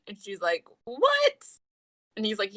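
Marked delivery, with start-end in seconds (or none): 0.8-1.4 very high, loud imitation of disbelief; mock shock. Imitating her hearing he's single, high shocked squeak. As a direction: shoot the pitch way up in surprise.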